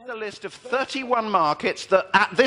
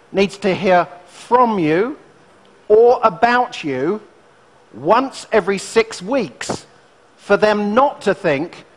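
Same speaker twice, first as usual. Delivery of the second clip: slow and louder, each phrase spaced out; slow emphatic point; 2 semitones higher, 6 dB louder, slower than usual. Acted. Urging business owners to keep in touch, slow and loud. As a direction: hammer it home phrase by phrase.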